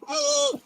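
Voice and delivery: very high, soft "oh"; mock squeal. Streamer mimicking his listener's reaction, high mock squeal. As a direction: imitate a tiny high-pitched squeal. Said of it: "He's now sitting there going".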